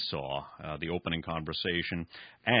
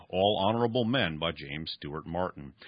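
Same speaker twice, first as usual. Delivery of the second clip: ordinary talk, nothing marked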